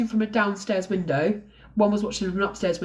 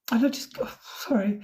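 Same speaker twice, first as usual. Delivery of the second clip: emotional; 3 semitones higher than usual. Real, not acted. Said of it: "sorry, I'm so emotional today".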